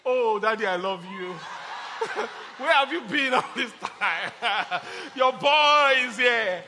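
laughing, hammed-up delight at a rich dad; mock overjoyed son greeting a rich father; gleeful fawning child. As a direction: gush over the newfound father with a laugh. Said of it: "You say".

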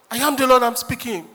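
indignant fake prophet; mock prophet. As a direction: insist like a prophet refusing to stop. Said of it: "Oga, Oga, no, no".

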